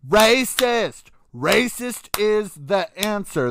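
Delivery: the obvious answer, yelled; exasperated shout. Real, not acted. Vlogger yelling the answer she is sure of, exasperated shout.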